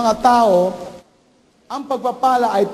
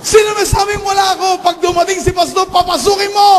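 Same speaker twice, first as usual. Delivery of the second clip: mother yelling; 13 semitones higher than usual. Retold or imitated. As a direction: bellow at the child from inside. Mother angrily shouting at child. (nanay na sumisigaw sa anak)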